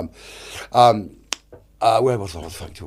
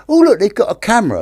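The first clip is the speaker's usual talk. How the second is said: high, louder mock excitement; naive delight; 10 semitones higher, 6 dB louder than usual. Short-sighted Neville spotting something on the destroyer, high mock delight. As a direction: exclaim it like an innocent spotter.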